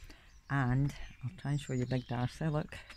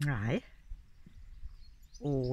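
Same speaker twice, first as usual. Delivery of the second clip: normal talking voice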